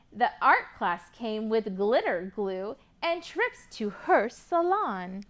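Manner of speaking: rhyming picture book read with lively lilt; reading to children. Acted. Teacher reading a rhyming book to kids, lively. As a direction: read the rhymes to a class of kids.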